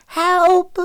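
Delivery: thin, very high cry; child's cry for help. A narrator's reading. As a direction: cry out like a frightened little boy. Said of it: "they heard a weak voice".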